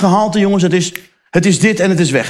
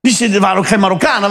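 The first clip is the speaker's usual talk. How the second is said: ordinary talk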